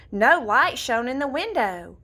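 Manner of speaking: plain reading voice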